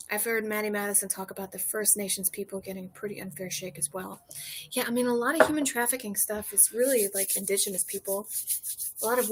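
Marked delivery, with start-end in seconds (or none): none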